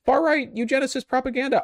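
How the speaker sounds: high whiny mock voice of a scold; hammed-up high nasal-free mimicry of an online critic. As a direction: sneer out the critic's objection in a high squeaky whine. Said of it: "I don't care how many people argue".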